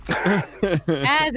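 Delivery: ordinary delivery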